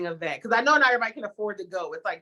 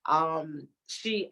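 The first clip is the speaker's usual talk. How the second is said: ordinary delivery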